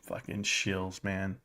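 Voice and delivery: low muttered grumble under breath; muttered aside. Real, not acted. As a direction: mutter it to yourself, disgusted.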